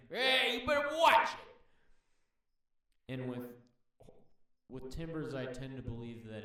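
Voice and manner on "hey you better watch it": high, loud mock-angry yell; threatening snake. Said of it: "There's two versions of this posture".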